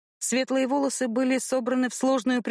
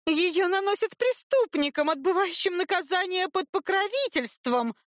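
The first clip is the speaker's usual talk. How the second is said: put-on haughty girl voice; 9 semitones higher than usual. A narrator's reading.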